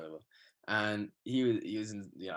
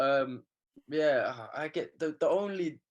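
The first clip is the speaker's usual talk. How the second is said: usual speaking voice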